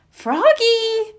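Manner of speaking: high, drawn call of Froggy; teacher calling Froggy across the pumpkin patch, high drawn call. A narrator's reading. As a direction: call him back across the field. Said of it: "called Miss Witherspoon".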